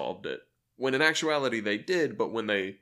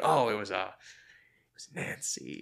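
mysterious caller; slower than usual. Retold or imitated. Mysterious caller reveals name.

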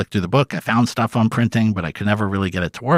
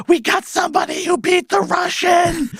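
retold shout. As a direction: yell it out across the office. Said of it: "he just yells out".

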